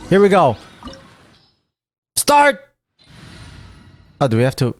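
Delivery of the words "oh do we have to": ordinary delivery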